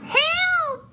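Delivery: very high, loud squeal; piercing storybook cry; witch crying for help. A narrator's reading. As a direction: shriek for help as the fleeing witch. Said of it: "cried the witch".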